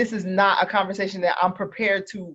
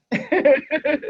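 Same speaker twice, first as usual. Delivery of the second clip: laughing; 5 semitones higher than usual. Real, not acted. Laughing about Vegas trip.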